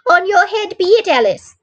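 high-pitched reproachful cry. A narrator's reading. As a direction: cry out high, dropping on the name. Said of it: "cried her mother".

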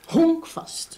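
sharp, high scornful snap of one word; scornful retort. Acted. Wife scorning the lazy guest to the detective, scornful. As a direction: spit it out with contempt.

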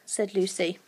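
ordinary delivery

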